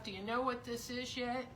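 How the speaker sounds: ordinary delivery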